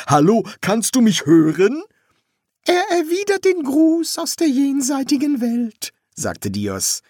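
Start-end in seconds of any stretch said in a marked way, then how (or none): 0.1-1.8 louder, raised, rising question; calling out. Acted. Son checking his dead father hears him, calling out. Son calls to unheard ghost. 2.7-5.9 very high-pitched put-on priest voice. Priest relaying the ghost's greeting to the son, high put-on voice.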